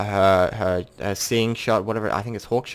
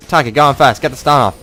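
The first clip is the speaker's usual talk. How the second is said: urgent call; 5 semitones higher, 8 dB louder than usual. Retold or imitated. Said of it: "I'm like".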